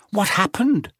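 put-on high, urgent girl's question; worried schoolgirl; concerned. A narrator's reading. Hermione concerned.

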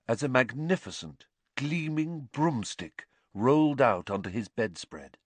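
ordinary delivery, nothing marked

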